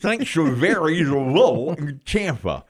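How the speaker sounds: talking around a pencil held sideways; pen in teeth. Shown as a demonstration. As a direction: diction drill with a pencil in mouth. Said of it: "you put it in the side of your mouth".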